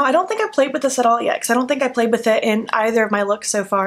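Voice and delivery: usual speaking voice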